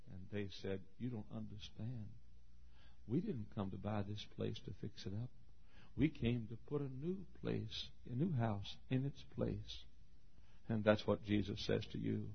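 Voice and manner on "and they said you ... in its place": hushed storytelling. A narrator's reading. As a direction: drop right down for the quiet lesson.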